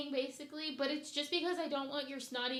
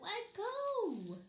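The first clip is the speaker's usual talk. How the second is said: much higher coaxing pet voice; 10 semitones higher, much slower than usual. Real, not acted. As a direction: sweet-talk the dog into letting go. Coaxing dog.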